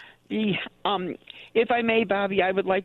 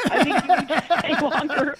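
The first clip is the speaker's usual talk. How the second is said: laughing plea; 4 semitones higher, 3 dB louder than usual. Real, not acted. Caller begs host to stay.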